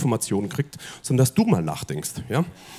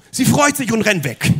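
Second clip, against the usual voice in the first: ordinary talk, nothing marked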